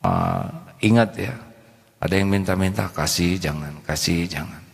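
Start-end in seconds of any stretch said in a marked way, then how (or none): 2.9-4.6 inner dilemma. Retold or imitated. Inner doubt about giving.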